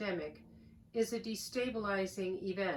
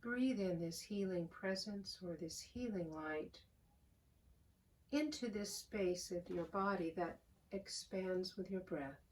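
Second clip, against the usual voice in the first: calm, unhurried voice with long pauses; soothing prayer-meditation delivery, phrase by phrase; guided meditation; 4 dB quieter than usual. Real, not acted. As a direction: guide them gently into the breath, softening as you go. Leads a guided breathing meditation.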